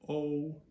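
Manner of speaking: O as the letter's name, high and level; long O. Shown as a demonstration. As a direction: hold a long O on one high note. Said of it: "take that long O".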